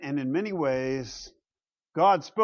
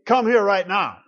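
louder, sharper parent command; stern command to kids; 4 semitones higher, 7 dB louder than usual. Shown as a demonstration. Father calling his kids, stern loud command. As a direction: call the kids in firmly. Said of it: "I can tell my kids".

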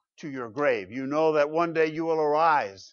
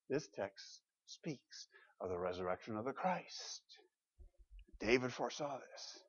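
hushed emphasis; 2 semitones lower, 14 dB quieter than usual. Real, not acted. Preacher confiding what the text speaks of, hushed emphasis. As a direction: let it sink in, almost under the breath.

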